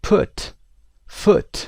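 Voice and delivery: teacher modelling the short oo in put and foot; short oo sound. Shown as a demonstration. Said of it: "صدای او کوتاه".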